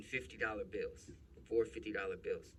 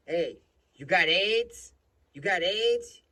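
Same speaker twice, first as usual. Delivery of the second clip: high, loud mock questioning with drawn-out words; taunting cop; 9 semitones higher, 13 dB louder, slower than usual. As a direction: needle him loudly, imitating a mocking cop. Retold cop taunts the father.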